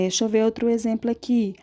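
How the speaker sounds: usual speaking voice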